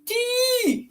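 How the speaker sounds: high squeal mimicking a toddler; tiny high-pitched toddler voice; imitating child. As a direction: squeak it like a little child's babble. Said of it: "she says".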